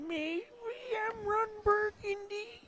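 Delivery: hammed-up high mock newsman voice; a silly high put-on celebrity voice; mock movie anchor. Fooling with co-caster between legs, mock anchor voice. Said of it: "I've been working my Trump impersonation".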